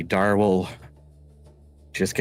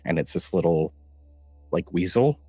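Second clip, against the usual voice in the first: ordinary talk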